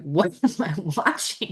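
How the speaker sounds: laughing through the question, drawn out; laughing hard. Retold or imitated. Telling friend about funny videos, laughing hard. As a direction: imitate helpless laughter at a video. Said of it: "I'm laughing so hard".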